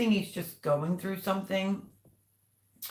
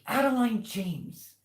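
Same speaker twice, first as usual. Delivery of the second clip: slow, high-pitched full-name reprimand; retold scolding; 7 semitones higher, 3 dB louder, slower than usual. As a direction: imitate a mother calling out a naughty child, drawn out.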